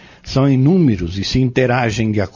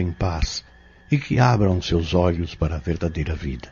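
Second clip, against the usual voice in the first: solemn prayer; 3 semitones lower, 3 dB quieter than usual. Acted. Fabrício prays for the tormented girl, solemn and pleading. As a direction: pray earnestly over the possessed girl.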